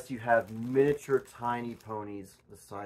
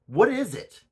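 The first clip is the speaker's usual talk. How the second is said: heated; 10 semitones higher, 5 dB louder than usual. Acted. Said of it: "Got very heated".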